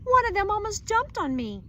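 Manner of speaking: high and loud in alarm; shrill fright. Acted. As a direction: still shaken, cry it out. Frightened wife complains.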